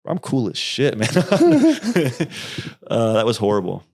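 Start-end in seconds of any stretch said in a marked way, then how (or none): 1.1-2.8 sudden high burst of laughter. Real, not acted. Recalling a horrible trip.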